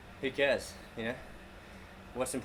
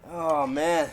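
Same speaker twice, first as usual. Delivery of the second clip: out of breath; 7 dB louder than usual. Real, not acted. Gasping after failed rep.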